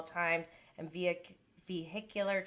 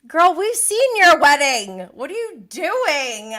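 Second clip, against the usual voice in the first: mock disbelief; 9 semitones higher, 18 dB louder, slower than usual. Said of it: "I was like".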